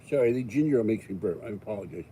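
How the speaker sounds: ordinary talk, nothing marked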